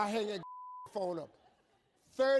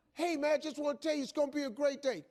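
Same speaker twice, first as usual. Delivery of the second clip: high-pitched, chirpy morning pep call; bursting with morning cheer; relentlessly upbeat caller; 7 semitones higher, faster than usual. Acted. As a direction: imitate an overly cheerful wake-up caller. Imitating sponsor's upbeat morning call.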